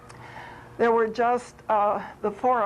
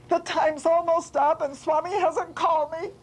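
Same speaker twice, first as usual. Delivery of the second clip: higher imitated fretting; mock complaint; 6 semitones higher than usual. Swami quoting devotee's daily grumble about waiting, mock complaining. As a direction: playfully mimic an impatient grumble. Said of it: "you've been very angry with Swami".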